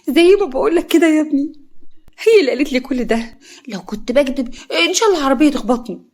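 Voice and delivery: fake crying. A narrator's reading. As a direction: wail it out in staged tears. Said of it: "فوزية بتعيط". (عياط مصطنع بصوت عالي)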